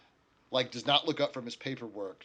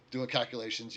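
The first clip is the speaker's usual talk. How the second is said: usual speaking voice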